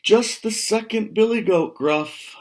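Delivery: high, loud confident goat; bigger goat voice. Acted. Second billy goat answers the troll, not so small. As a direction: pipe up bold and sure. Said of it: "in a voice that wasn't so small".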